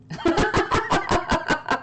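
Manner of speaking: laughing out at the bot's reply; delighted high laugh. Real, not acted. Streamer laughing at the eight ball's answer, high burst of laughter.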